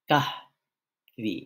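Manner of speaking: "কাহ ভি" split, weight on "কাহ", "ভি" let fall; first-syllable stress. Shown as a demonstration. Teacher showing how coffee is pronounced, first syllable stressed in "কাহ ভি". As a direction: stress "কাহ", pause, drop "ভি". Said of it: "এই রকমভাবে উচ্চারণ করতে হবে".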